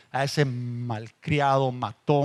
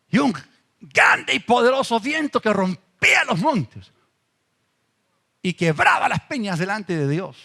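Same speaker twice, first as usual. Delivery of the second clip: booming dramatic reading; 4 semitones higher, 5 dB louder than usual. Acted. Preacher dramatizing the mighty wind.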